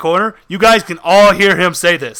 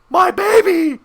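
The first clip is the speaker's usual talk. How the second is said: mock scream in a put-on woman's voice; imitated woman screaming; 11 semitones higher than usual. Boogeyman wailing for his baby off in the distance, womanly scream. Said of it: "trying to do, like, a, a woman".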